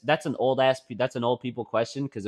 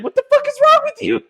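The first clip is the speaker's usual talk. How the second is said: high, loud, half-laughing cry; laughing outburst; 12 dB louder than usual. Retold or imitated. Daughter imagining yelling at mom, laughing. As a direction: screech it through laughter. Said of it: "I wanted to be like".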